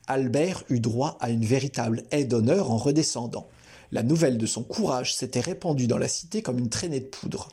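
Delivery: plain reading voice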